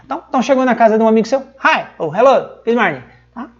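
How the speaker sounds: usual speaking voice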